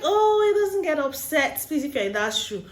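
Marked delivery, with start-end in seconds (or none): 0.0-1.7 high-pitched imitation of a woman's denial; lightly exaggerated, high protest; mock airy denial. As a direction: brush the idea off in a high voice. Woman insists fiancé never gets upset.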